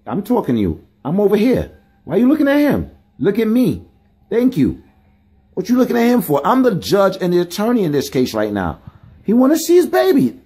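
indignant mock judge telling her off; exasperated scolding. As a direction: demand she look at you, voice up high.

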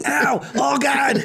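high hammed-up yelps; high mock cries of pain; mock pain cry. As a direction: ham up a pained cry.

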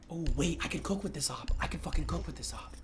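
hushed, excited whisper to himself. Real, not acted. Picking his operator, he whispers excitedly to himself. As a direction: whisper it like a secret find.

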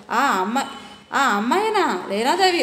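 ordinary delivery

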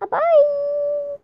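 high, long-held goodbye; sign-off farewell. Real, not acted. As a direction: stretch the goodbye out on one high note. Signing off to viewers.

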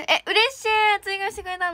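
high, loud happy squeal; delighted. Real, not acted. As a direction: burst out with real joy. Fan added handshake tickets. (本気で嬉しそうに高く叫ぶ)

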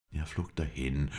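ordinary delivery, nothing marked

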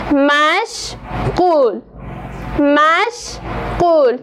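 مشغول said very slowly, split into two drawn-out syllables. Shown as a demonstration. Teacher syllabifying مشغول twice for the class, very slow. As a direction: stretch each syllable of مشغول. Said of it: "کلمهٔ مشغول".

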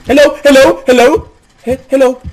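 high, loud calls into the dark; calling out loudly. Real, not acted. Player calling into the empty house, loud and high. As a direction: shout hello to whoever's there.

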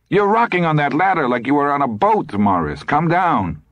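high-pitched insistent nagging; alarmed wifely scolding, high. A narrator's reading. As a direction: urge him down, exasperated. Wife nags husband on ladder.

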